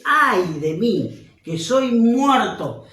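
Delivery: loud, very slow anguished cry; slow wailing prophet's cry; lament of despair. Acted. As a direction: stretch every word like a man facing death. Reading Isaiah's cry of despair.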